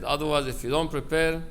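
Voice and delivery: usual speaking voice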